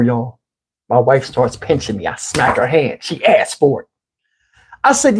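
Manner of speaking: ordinary talk, nothing marked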